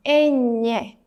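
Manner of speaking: "ñ" said as a nasal ny sound, tongue flat on the palate; Spanish ñ. Shown as a demonstration. Teacher showing how ñ differs from N. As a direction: flatten the tongue and say "ñ". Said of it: "flatten your tongue at the top of your mouth".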